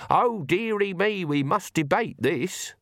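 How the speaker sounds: high, slow, fretful mimicry; drawn-out mock dismay, pitched high; mock hand-wringing. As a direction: mimic a helpless ditherer. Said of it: "wring your hands and say".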